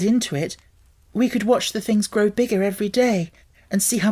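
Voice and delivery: high, wistful and persuasive; coaxing girl. A narrator's reading. Mary coaxing Colin to want a secret garden, wistful. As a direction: paint spring so he longs for it.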